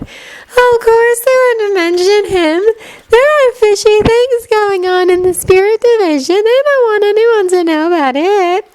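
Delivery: put-on high, slow, dreamy girl voice; dreamy Luna voice. A narrator's reading. Luna dismissing the Prophet, high and dreamy. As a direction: float the words like a dreamy girl.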